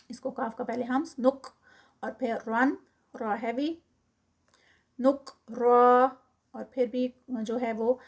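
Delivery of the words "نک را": نک را with a heavy را stretched two counts; recited stop form. Shown as a demonstration. Teacher showing the stopping form, stretched. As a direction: stop on نک را and hold the vowel. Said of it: "را heavy ہی رہے گی اور ٹو counts کا stretch".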